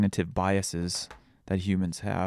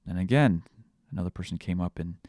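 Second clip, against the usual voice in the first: usual speaking voice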